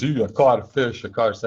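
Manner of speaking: mocking friend gloating over his catch. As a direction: taunt like a gloating kid. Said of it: "and he was mocking".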